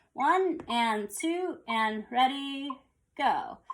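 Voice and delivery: slow, evenly spaced count-in. Shown as a demonstration. Teacher setting up the ta exercise, slow rhythmic count-in. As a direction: count them in steadily on the beat. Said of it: "I'm gonna do this with tas now".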